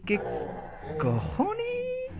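very high, squeaked disbelief; high-pitched startled swear; baffled surprise. Real, not acted. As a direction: squeak it out in comic shock. Startled reaction to odd creatures.

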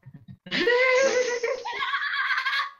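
high, loud burst of laughter; wild loud laugh. Real, not acted. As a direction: cackle out loud. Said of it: "What the hell is that laugh?".

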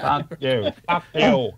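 attempted New York accent curse. Shown as a demonstration. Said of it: "That's New York".

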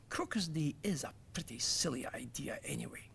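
soft, low, whispered admission; sheepish whisper. Acted. Little Nose conceding to Two Eyes, sheepish whisper. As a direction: mutter it sheepishly under the breath. Embarrassed boy admits defeat quietly.

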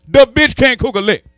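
ordinary talk

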